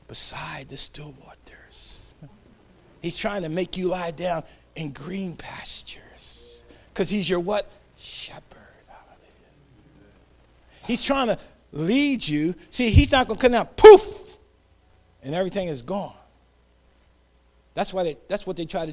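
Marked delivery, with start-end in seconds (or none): 0.1-6.6 slow breathy whispered psalm line. Real, not acted. Preacher whispers the Psalm to the congregation, slow whisper. 8.0-10.1 soft whispered answer, trailing off. Real, not acted. Preacher answers his own question, hushed whisper. 13.8-14.4 sudden much higher and louder poof; sound effect poof. Retold or imitated. Preacher mimics things vanishing, loud high poof. God making everything vanish.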